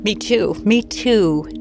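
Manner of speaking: ordinary talk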